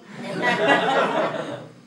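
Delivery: loud high laugh at yourself; real laughing burst; embarrassed laugh. Real, not acted. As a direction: laugh it off, self-mocking. Laughing at looking foolish.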